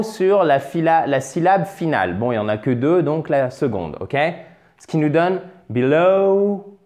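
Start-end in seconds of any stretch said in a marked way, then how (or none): 5.7-6.8 be-LOOOW, dragged on the second sound; drawn-out final syllable. Shown as a demonstration. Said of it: "on fait traîner".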